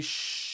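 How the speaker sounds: long drawn-out shush; playful shush. Pretend. Streamer telling viewers don't tell anyone, long playful shush. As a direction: hush everyone with a long hiss. Hushes viewers after comment.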